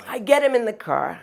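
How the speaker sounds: usual speaking voice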